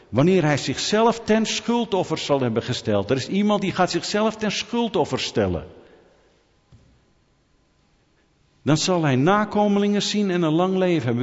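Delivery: high, ringing sermon delivery; preaching. Acted. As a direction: declaim the prophecy from the pulpit. Proclaims and expounds Bible verse.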